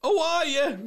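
high squeak of realisation; sudden realisation. Real, not acted. Getting the pun at last, squeaking in surprise. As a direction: it just clicked.

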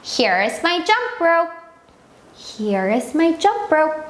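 very slow, clear teacher demo; slow model sentence. Shown as a demonstration. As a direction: stretch each word out for the kids. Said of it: "여기 내 줄넘기 있어". (아주 천천히 또박또박)

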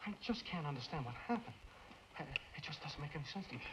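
soft, troubled puzzlement; quietly lost for an answer; bewildered, subdued. Acted. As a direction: drop to a baffled near-murmur. Bewildered over friend's death.

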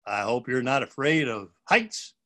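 ordinary delivery

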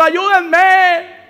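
high, loud plea through a locked door; desperate cry for help. Acted. A man pounding on the door, crying out desperately for help.

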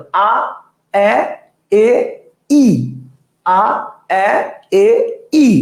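আ, এ, এ, ই one by one, moving from open to flat-lipped front; vowel demonstration. Shown as a demonstration. Teacher asks students to notice, stepwise আ-to-ই vowel demonstration. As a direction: isolate each vowel, আ to ই, spreading the lips gradually. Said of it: "তাহলে খেয়াল করেন".

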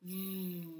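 a long buzzed vvv with lips on teeth; buzzing V sound. Shown as a demonstration. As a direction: vvv buzz. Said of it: "You're gonna go".

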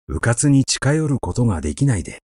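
ordinary delivery

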